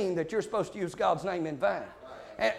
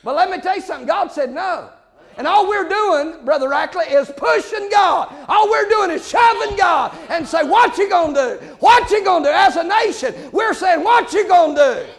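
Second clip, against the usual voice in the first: loud, higher shouted sermon, slowing on the key words; full-force preaching; 8 semitones higher, 8 dB louder than usual. Acted. Preacher shouting down excuses about the court, full-force preaching. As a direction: thunder it at the congregation.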